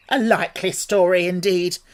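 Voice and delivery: loud, scornful sneer; contemptuous. A narrator's reading. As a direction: pigeon scoffing in contempt. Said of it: "in a tone of the deepest contempt".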